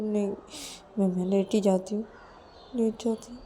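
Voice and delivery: plaintive, subdued talking to herself; forlorn Ananya, muttering. Acted. Ananya frets alone as Payal lies down, forlorn self-talk.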